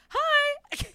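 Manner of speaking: very high, small greeting; a shy little high hi; timid girly hi. Retold or imitated. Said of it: "I'm just gentle girl".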